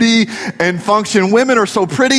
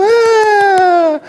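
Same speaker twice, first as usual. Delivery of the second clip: long, high mimicked shriek; little girl's squeal; 13 semitones higher, 4 dB louder than usual. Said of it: "And she'd go".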